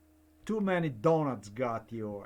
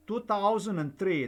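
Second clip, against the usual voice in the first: "Two thousand and three" said higher and louder, hitting "thousand"; emphatic repeat; 7 semitones higher, 5 dB louder than usual. Real, not acted. Insisting on the year to the listener, "Two thousand and three" stressed. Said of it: "Do you know why I stated".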